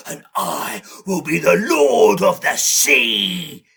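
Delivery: loud, swelling proclamation; big booming declaration; grand boast. Acted. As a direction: roar it out like a conquering king.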